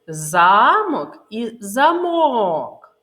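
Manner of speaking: slow Замок і замок, first with stress on А, then with stress on О; slow, loud contrast of the two stresses in Замок і замок. Shown as a demonstration. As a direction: spell out both stresses slowly: ЗАмок, then замОк. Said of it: "вимовляється голосніше".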